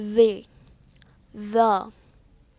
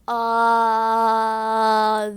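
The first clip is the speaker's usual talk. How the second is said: low voiced buzz held long; vibrating hum; 5 semitones lower than usual. Shown as a demonstration. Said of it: "with vibration".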